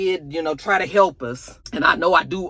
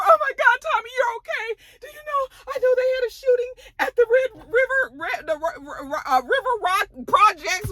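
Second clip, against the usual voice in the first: much higher hammed-up tearful woman; mock sobbing. Maria to Tommy after the shooting, hammed-up sobbing. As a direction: gush and sob, overacted.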